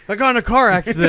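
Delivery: panicked caller. Retold or imitated. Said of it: "She's like".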